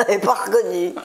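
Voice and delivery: laughing through the words; real chuckle breaking around the phrase; amusée. Real, not acted. Laughs at not being recognised. (en riant)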